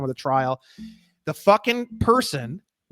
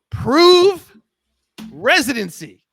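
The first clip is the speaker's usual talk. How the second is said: ordinary talk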